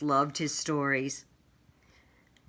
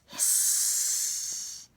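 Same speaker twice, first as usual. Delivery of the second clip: hiss; 4 dB quieter than usual. Acted. Flames flare from nostrils.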